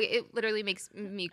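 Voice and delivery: ordinary talk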